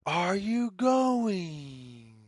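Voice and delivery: ordinary delivery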